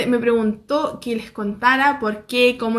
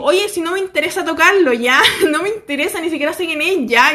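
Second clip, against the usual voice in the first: louder, higher retold protest; indignant complaint; 4 semitones higher, 4 dB louder than usual. Vlogger retelling her complaint to the bodyguard, indignant. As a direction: tell him off, mock-indignant. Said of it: "empiezo a reclamarle al loco".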